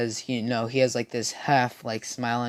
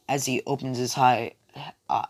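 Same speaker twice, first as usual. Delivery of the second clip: ordinary delivery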